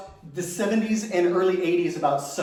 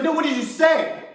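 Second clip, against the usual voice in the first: pressing mom; 8 semitones higher than usual. Retold or imitated.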